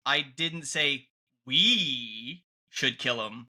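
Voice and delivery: "We" stretched and punched; slow, hit "We" hard then drop; stress on WE. Shown as a demonstration. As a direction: lean long on "We", the rest falls away. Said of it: "emphasize a different word in the sentence".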